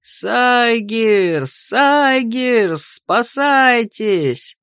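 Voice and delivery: drawn-out cry of alarm, held back in volume; wild yelling. A narrator's reading. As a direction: stretch each word like a distant warning yell. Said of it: "в диком оре нечто внятное".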